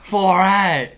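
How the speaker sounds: playful fake Australian; Australian accent. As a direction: join in with a jokey Australian accent. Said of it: "I'm gonna talk in Australian accent".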